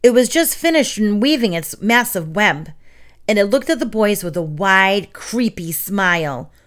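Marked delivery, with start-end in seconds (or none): none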